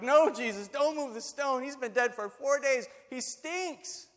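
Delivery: high-pitched, urgent objection; alarmed protest. Acted. Mary and Martha protest to Jesus.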